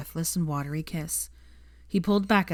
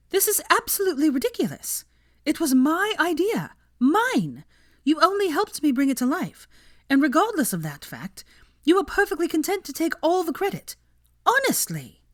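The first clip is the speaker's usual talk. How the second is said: high, loud outraged rant; indignant scoffing; 11 semitones higher, 4 dB louder than usual. A narrator's reading. Hermione berating Malcolm, scoffing and indignant. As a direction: tear into him with contempt.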